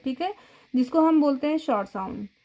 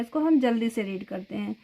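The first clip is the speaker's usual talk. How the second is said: ordinary talk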